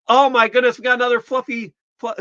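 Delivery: bright, loud, high burst of delight; excited greeting. Real, not acted. Host thrilled to greet another viewer, loud and high. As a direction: greet the newcomer with a happy shout.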